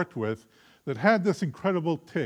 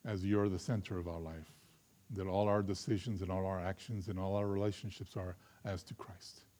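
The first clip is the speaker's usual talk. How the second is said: closing prayer; 3 semitones lower than usual. Acted. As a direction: pray gently over the congregation.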